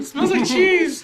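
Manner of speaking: high, slow squeaky complaint. Acted. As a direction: drag it out in a squeak. Complaining about the hat.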